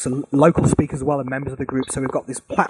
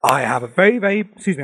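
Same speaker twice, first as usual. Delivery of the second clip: ordinary talk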